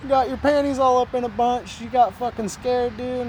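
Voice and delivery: high-pitched, loud-starting heckle; teasing imitation of scoffing locals; mocking jeer. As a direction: razz them like old drunks at the bar. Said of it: "just kinda laughing at us".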